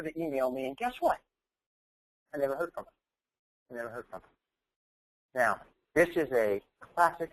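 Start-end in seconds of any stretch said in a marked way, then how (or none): 3.7-4.3 quiet repeat. Real, not acted. Speaker repeating the disappointing outcome to the audience, much softer.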